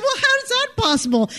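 pitched far up in disbelief; shocked that photos exist; incredulous. Retold or imitated. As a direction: ask it stunned, voice jumping high. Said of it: "and I said".

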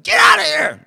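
loud retold yell, voice jumping high; angry yell. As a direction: bark him off the porch at full volume. Angry neighbor chasing them off.

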